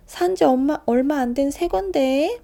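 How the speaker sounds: high, loud, slow lament; upset mother. Acted. Mother finding her new shoes cut, loud slow lament. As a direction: wail over the ruined shoes.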